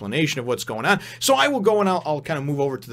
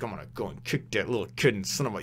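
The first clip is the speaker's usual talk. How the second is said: fast, softer grumble under the breath; mock angry grumbling; 3 semitones lower, 6 dB quieter, faster than usual. Naruto follows the little kid upstairs, mock angry grumbling. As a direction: mutter an annoyed threat, half-acted. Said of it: "'cause he's mad about what he said".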